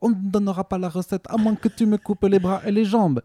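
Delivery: high hammed-up Italian accent. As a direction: menace the customers in a cartoon Italian accent. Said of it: "je vais le faire avec l'accent italien". (le gérant menace les clients, accent italien exagéré)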